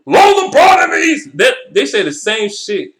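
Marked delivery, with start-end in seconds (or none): 0.1-1.4 high, loud mocking shout of the pundits; loud sarcastic parody of the haters. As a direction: yell it like a know-it-all fan, mocking them. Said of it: "for people to say".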